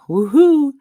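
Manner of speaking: a loud, high whoop of joy; excited cheer. Real, not acted.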